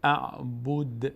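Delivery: اعبد very slow with the final د bounced; slow recited اعبد with a rebounding dal; qalqala on dal. Shown as a demonstration. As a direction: let the د in اعبد echo with a bounce. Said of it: "این دال اگه اینجا ساکن شد".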